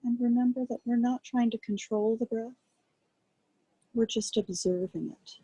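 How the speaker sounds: calm, quiet guiding voice with long pauses; guided meditation. Acted. Teacher guiding a group to rest attention on breath, calm meditation voice. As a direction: ease listeners gently into noticing the breath, unhurried.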